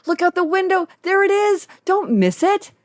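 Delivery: high-pitched, eager imagined call; excited calling out. Retold or imitated. Plane passenger imagining calling to fellow passengers, excited. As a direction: call it out high, urging everyone to see. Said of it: "I wanted to call out to my fellow passengers".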